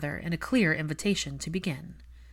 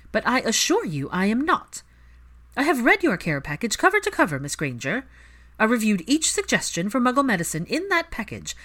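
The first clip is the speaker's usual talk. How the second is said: higher, louder, haughty and controlled; cool matriarch defending her choice; proud aristocratic mother; 5 semitones higher, 5 dB louder than usual. Acted. Narcissa justifying herself to the healer, haughty and firm. Narrator voices Narcissa at length.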